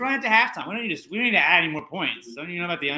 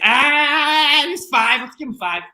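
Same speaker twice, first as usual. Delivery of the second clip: high, careless mock official; careless timekeeper; 10 semitones higher, 6 dB louder, slower than usual. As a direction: toss it off like a lazy timekeeper. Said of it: "he's like".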